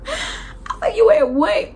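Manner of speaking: high pitched incredulous yell through laughter; shocked outrage. Retold or imitated. Outraged at Ben being at work.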